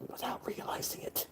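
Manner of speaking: voiceless, very soft whisper. Real, not acted. Admitting accent slips as an aside, whispered. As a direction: let it drop into a whisper.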